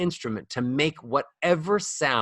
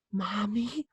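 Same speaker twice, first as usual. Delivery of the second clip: put-on soft, airy, higher baby call; softer airy toddler voice; 5 semitones higher, 7 dB quieter than usual. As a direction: breathe the word like a toddler copying mom. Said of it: "I speak airy".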